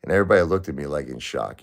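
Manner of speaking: ordinary delivery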